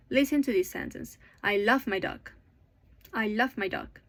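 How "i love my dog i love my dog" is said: LOVE and DOG stressed, "I" and "my" lighter; I LOVE my DOG, as a rhythm demo; content-word stress. Shown as a demonstration. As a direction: put the beats on "love" and "dog". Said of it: "love and dog are the important words".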